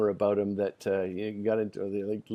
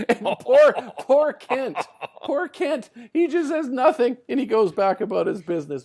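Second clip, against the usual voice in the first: high, loud voice through laughter; laughing while talking; 12 semitones higher, 6 dB louder than usual. Real, not acted. Storyteller pitying poor Kent, voice high with laughter. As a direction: push the words out through laughter. Still laughing after punchline.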